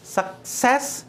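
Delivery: "Success" with सेस stressed; short सक, then lean on सेस in "success"; word demo, "success" stressed on its second syllable. Shown as a demonstration. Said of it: "second syllable पर stress है".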